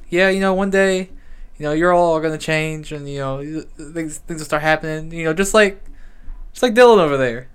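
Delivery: a hint of a preachy teacher; lightly voiced lecturing teacher. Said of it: "he said something about".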